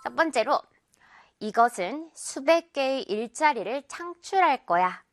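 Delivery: ordinary talk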